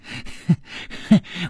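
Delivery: breathy, unhinged little chuckle; faint mad giggle; soft eerie laugh. A narrator's reading. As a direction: laugh quietly to yourself, entranced. Gong Nanyan laughs mid-line.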